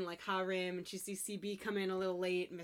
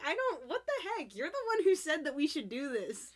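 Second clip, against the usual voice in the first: high-pitched, incredulous arguing; imitated exasperated girlfriend in a quarrel; indignant protest; 8 semitones higher than usual. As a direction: throw the blame back in mock outrage. Retold quarrel, heroine's protest.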